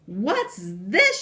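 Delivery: high, loud shriek; shrieking queen. Acted. Queen spots a boy in throne room, loud shriek. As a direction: shriek it in outrage. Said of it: "she shrieked".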